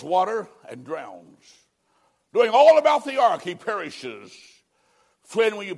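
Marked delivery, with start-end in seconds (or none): 2.3-3.4 much higher and far louder, full-force preaching; climactic shout. Acted. Preacher thundering at the congregation, full shout. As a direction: bellow it at the climax.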